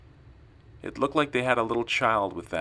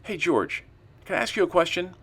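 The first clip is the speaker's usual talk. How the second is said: high-pitched, louder call to the boss; blurted question; 10 semitones higher, 4 dB louder than usual. Acted. Narrator hails his boss with an awkward question, blurting. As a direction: pipe up as if it just slipped out. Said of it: "I blurted out".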